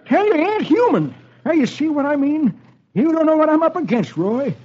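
very high, worked-up character voice; agitated Dolfo. Acted. Dolfo telling Roy of his trouble, agitated high voice. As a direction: protest in a high, excitable old-timer voice.

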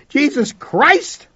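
anguished cry of disbelief; shouting. A narrator's reading. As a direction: yell it out slowly in shock. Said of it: "I shouted".

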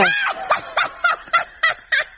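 high shrieking giggle; helpless laughter. Acted. Debbie hearing about Jenkins and Lucretia, shrieking laughter. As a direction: burst into high-pitched hysterics.

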